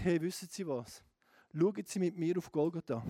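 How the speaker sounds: normal talking voice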